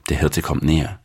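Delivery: plain reading voice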